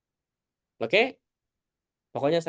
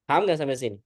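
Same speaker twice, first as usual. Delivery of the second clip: ordinary talk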